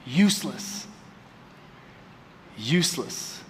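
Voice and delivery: soft, low, heavy repeat; quiet emphatic. Real, not acted. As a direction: let the word sink in quietly.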